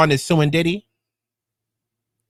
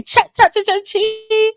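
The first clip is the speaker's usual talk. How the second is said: sing-song nickname; slower than usual. Pretend. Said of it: "I be saying".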